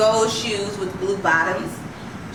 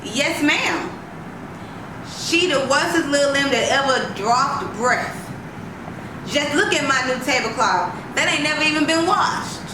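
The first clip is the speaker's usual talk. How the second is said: higher, aggrieved complaint; indignant grandmother; 5 semitones higher than usual. A narrator's reading. Grandma complains indignantly to the lady about the child. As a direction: pour out grievances to the lady.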